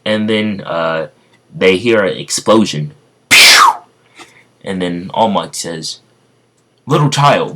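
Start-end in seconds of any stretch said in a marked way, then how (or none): 3.3-3.6 loud mouth explosion pew; explosion noise. Acted. Explosion sound effect. 6.9-7.5 hero greeting. Acted. All Might addresses Deku.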